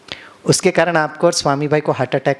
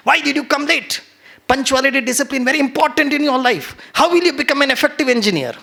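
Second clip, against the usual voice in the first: a stern teacher's high, slow scolding; angry scolding; 9 semitones higher, much slower than usual. Retold or imitated. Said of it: "मैं भड़कता था". (देर से आए छात्र को ऊँची, धीमी आवाज़ में गुस्से से डाँटना)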